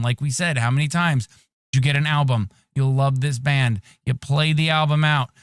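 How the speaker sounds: usual speaking voice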